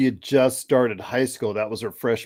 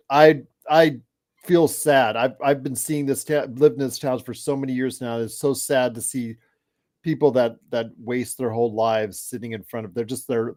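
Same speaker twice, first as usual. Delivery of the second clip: ordinary talk, nothing marked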